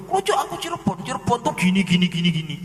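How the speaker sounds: high, insistent protest; insistent claimant. Retold or imitated. The claimant insisting to a local, high mock protest. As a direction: protest high and quick, then trail into gini.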